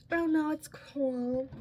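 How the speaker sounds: drawn-out, sugary reassurance; sweet voice. Retold or imitated. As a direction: imitate a scary guy's sweet voice. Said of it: "He had a very sweet voice though".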